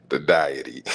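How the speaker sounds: low mock misreading breaking into a laugh; real amusement, word tipping into giggles. Real, not acted. Man recalling the botched word, laughing. Repeats the misreading while cracking up.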